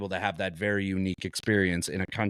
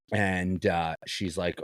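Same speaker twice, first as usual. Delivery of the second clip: usual speaking voice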